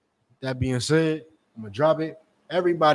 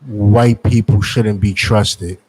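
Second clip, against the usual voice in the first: deadpan father; 5 semitones lower, 4 dB louder, much slower than usual. Retold or imitated. Father's deadpan answer to reporter.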